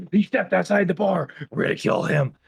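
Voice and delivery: a lightly voiced panicked cop; alarmed overzealous cop. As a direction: play an overeager cop crying murder. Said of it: "taken to the extreme of".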